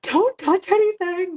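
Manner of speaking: high, loud and slow warning; panicked warning. Retold or imitated. Mom texting her son at the crime scene, loud alarmed warning. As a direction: shout it before he touches evidence. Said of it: "and I'm like".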